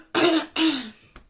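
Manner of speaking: loud rough throat clear. Real, not acted.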